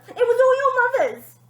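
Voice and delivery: very high, loud squeal; father pointing the finger; panicked blame. Acted. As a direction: blurt it out in panic.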